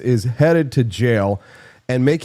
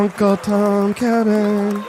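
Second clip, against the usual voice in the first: drawn-out mock title; 7 semitones higher, slower than usual. Title repeated as gag.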